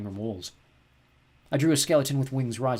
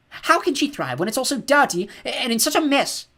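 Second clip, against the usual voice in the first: much higher and louder, slow and strained; upset outburst; 13 semitones higher, 8 dB louder, slower than usual. A narrator's reading. Mum rails at the dirty house, upset outburst high and loud. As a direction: let it burst out at the house.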